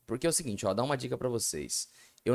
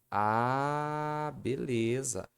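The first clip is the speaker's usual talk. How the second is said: ordinary talk, nothing marked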